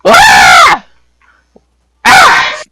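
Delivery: loud, high acted screams; mom's mock scream. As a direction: scream twice like a horrified mom. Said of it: "your mom has screaming".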